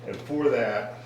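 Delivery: ordinary delivery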